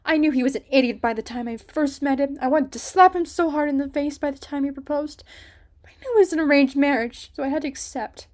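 furious complaint about the arranged marriage; indignant rant. A narrator's reading. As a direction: vent her contempt for the fool. Ruby rants about her suitor.

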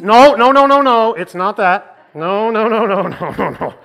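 loud burst of rapid no's; hasty denial. Acted. Church member stopping an awkward guess, loud hurried denial. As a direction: cut them off loudly, easing into laughter. Hastily cutting off the suggestion.

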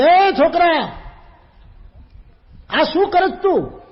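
high, loud shout at the son; angry shout. Retold or imitated. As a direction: yell at the boy caught wasting rice. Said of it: "રાડ નાખી કે".

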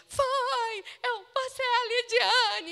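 finally recognizing the friend she gave the jacket to; joyful recognition shout. Retold or imitated. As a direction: shriek it like you just found a lost friend.

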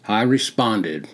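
ordinary delivery, nothing marked

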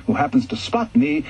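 plain reading voice

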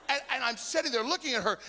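ordinary talk, nothing marked

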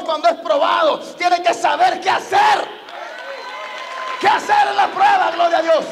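high, impassioned sermon delivery; full-force preaching. Real, not acted. Preacher telling the congregation of Job under trial, full-force preaching. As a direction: preach it out with drawn-out phrase endings.

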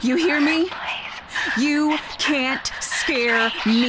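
defiant shout. Acted. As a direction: yell to prove you're not scared. Shouting defiance at unseen thing.

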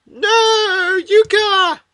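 high, loud wail of protest; drawn-out high shout; anguished cry. Acted. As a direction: cry out to a dying friend, voice way up. Acting character's reaction to a death.

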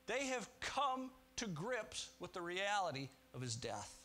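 ordinary talk, nothing marked

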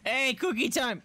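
high, gleeful call; excited announcing. Real, not acted. Player announcing snack time, excited high call. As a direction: call it out like a treat has arrived.

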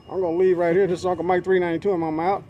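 usual speaking voice

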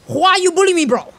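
high, loud aggrieved cry; loud protest. Real, not acted. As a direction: shout it as a wounded complaint. Mock protest at being beaten in game.

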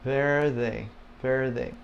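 "Verde" with a light R and weak D; soft R and D. Shown as a demonstration. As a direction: say "Verde" softly, easing off the R and D. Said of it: "the R is not real strong".

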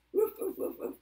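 quick high, loud woofs; a run of doggy barks. Pretend. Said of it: "what does a doggy say".

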